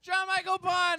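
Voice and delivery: host hyping the winner to the audience; announcer call-out. Real, not acted. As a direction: pump up the room. Host calls out contestant name.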